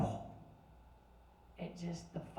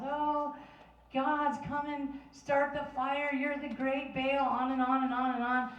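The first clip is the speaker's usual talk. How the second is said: mock pleading priests; 6 semitones higher than usual. Priests calling out to Baal.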